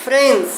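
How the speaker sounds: ফ্রেন্ডস modelled as "frens"; silent D in plural. Shown as a demonstration. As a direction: leave the D out of ফ্রেন্ডস, ending in -ns. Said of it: "তাহলে ডি উচ্চারণ হবে না".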